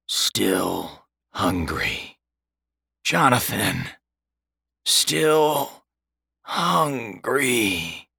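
slow, hollow ghostly voice; ghostly spirit voice. A narrator's reading. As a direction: drag it out like a hungry ghost. Hungry spirit storm speaks.

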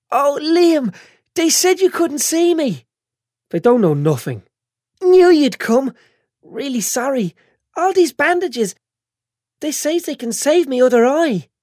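put-on high, tearful, whiny girl; a bandaged girl fighting tears; whiny young woman. Chantal voiced tearful in hospital.

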